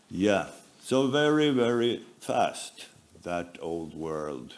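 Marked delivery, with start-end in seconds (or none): none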